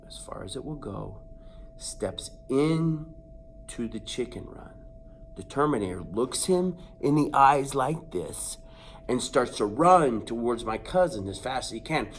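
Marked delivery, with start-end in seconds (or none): none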